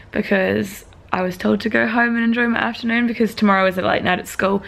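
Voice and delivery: ordinary talk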